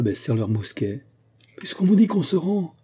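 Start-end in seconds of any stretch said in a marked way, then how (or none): 1.6-2.7 high-pitched protesting call; skipper's cry. A narrator's reading. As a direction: cry out your surrender to the attackers. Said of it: "cria le patron Yves".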